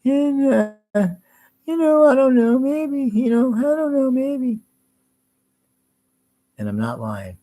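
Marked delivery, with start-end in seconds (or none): 0.0-4.6 very high, louder mocking voice, dithering; mock Ben. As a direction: squeak out a confused, hesitant fool. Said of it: "Ben talks like this".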